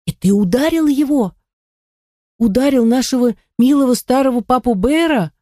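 high-pitched, outraged question; scandalised boy, voice rising high; indignant outcry. A narrator's reading. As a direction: exclaim in angry disbelief, pitch leaping up. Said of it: "воскликнул Нед и в припадке гнева".

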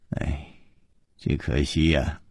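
low, soft sigh of regret; long sigh. A narrator's reading. Chu lamenting to the girls, sighing. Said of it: "忽然长叹了一口气".

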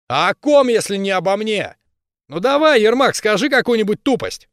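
high, loud thuggish yell; a brawler spoiling for a fight; berserker shouting. Acted. Bully taunts hero.

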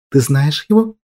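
usual speaking voice

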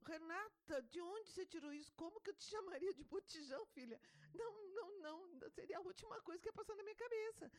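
high, hurried motherly denial; incredulous protest. Retold or imitated. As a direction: re-enact a mother flustered by her child's accusation. Mother protesting to her daughter.

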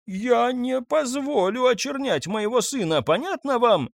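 high, indignant outburst; boiling over. A narrator's reading. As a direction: flare up in defence of her son. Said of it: "вскипела миссис Нотбек". (вспылив, высоко и возмущённо)

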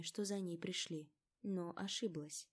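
usual speaking voice

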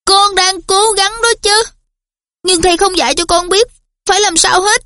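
high, frustrated outburst; angry. A narrator's reading. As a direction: protest hotly, voice up. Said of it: "Harry tức tối nói".

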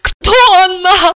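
high, slow mock sob; tearful question. A narrator's reading. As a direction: wail it slowly, high in the voice. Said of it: "рыдая, спросила".

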